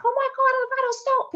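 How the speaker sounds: very high-pitched mock scream of amazement; retold scream. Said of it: "And I screamed".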